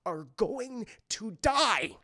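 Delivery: slow, tense, emphatic mock threat; acted exasperated order, ending loud; urgent warning. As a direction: warn a friend through gritted teeth. Chip warns Gadget urgently.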